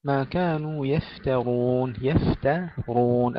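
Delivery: "يفترون" slow, light taa, heavy raa; tajweed demo. Shown as a demonstration. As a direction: draw out "يفترون" carefully. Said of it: "التاء مرققة والراء مفخمة".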